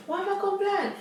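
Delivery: high, giggling through the words; laughing at herself. Real, not acted. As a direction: laugh it off, embarrassed at going blank. Laughing at own mind blank.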